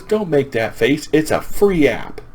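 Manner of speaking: high, slow retort; mock chiding. Grandma answers grandson's fuss about cost, high mock chiding. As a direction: draw it out high, chiding in play.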